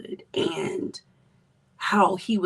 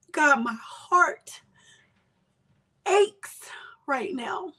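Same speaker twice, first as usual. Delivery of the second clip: higher, slow, pleading prayer to God; acted anguished prayer; 6 semitones higher, slower than usual. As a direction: cry out to God in pain, slowly. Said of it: "It's okay for me to say".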